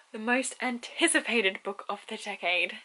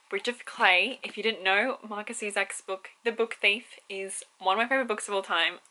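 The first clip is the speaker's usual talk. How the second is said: usual speaking voice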